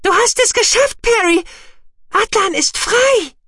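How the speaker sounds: loud, very high squeaky cheering; exultant little creature, stretching the last words; jubilant creature. A narrator's reading. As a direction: squeal the good news with joy. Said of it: "jubelte Guki".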